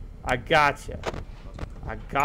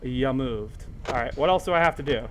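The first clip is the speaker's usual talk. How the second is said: normal talking voice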